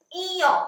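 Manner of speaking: इयो said high with spread lips; teacher modelling इयो for the class; mouth shape demo. Shown as a demonstration. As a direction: stretch the lips wide on इयो. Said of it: "मेरे मुंह की तरफ देखिए कैसे मैं बोल रही हूं".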